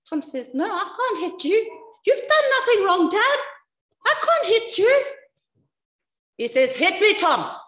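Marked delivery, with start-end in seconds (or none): 0.5-5.0 put-on high, loud protest of a boy; distressed refusal. Son refusing to hit his innocent dad, distressed refusal. 6.7-7.6 raised, loud order; insistent command. Retold or imitated. Dad insisting his reluctant son hit him, insistent command.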